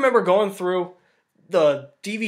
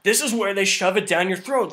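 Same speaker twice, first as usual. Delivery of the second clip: ordinary delivery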